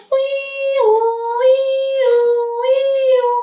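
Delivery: high sung siren wail, wiu wiu; playful sung siren noise; siren imitation. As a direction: mimic an ambulance siren for kids. Siren again.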